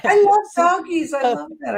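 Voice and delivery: high, loud, delighted; delighted squeal. Real, not acted. Host squeals with delight at the barking. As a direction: gush over the doggies.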